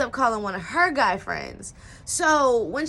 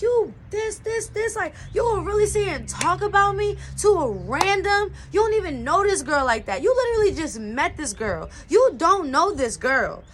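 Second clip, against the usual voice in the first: mock angry rant; 6 semitones higher than usual. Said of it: "I'm, like, loud now".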